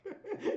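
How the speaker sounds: short high laugh. Real, not acted. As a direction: laugh at the memory. Laughs at the memory.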